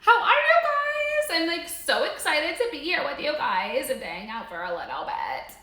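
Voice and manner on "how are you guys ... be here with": very high, slow, drawn-out hello; squeaky high greeting. Pretend. Host greeting viewers in a silly high squeaky voice. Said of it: "I don't know why I'm talking like that".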